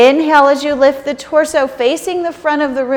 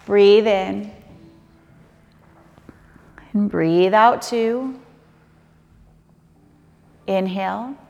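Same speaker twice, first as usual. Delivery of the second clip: softer, slow counting of breaths; 3 semitones lower, 4 dB quieter than usual. Acted. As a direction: count quietly, leave long gaps. Yoga teacher counts held breaths.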